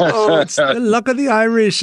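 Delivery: high, hammed-up Irish leprechaun lilt; a cartoon leprechaun boasting of Irish luck; high mock Irish accent. Host imitates ad leprechaun, high Irish accent. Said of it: "his voice is high".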